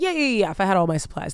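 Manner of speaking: fast, hurried yeahs; rushed agreement. Retold or imitated. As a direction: rattle it off to get past it. Rushed defensive reply to stylists.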